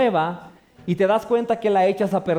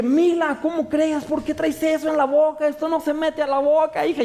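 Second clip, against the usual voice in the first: put-on higher-pitched, alarmed scolding of the baby; scared scolding; 5 semitones higher than usual. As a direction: scold the little daughter in a fright. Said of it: "me asusté y la empecé a regañar".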